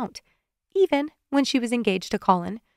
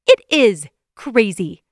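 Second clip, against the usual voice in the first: louder, slow and forceful; vehement shout; 7 dB louder, slower than usual. A narrator's reading. Amanda shouts vehemently at Evan, loud and slow. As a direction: hammer each word vehemently. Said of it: "she shouted vehemently".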